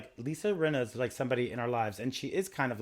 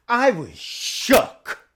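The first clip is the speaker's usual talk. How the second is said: mock dramatic shook; 12 semitones higher, 13 dB louder, much slower than usual.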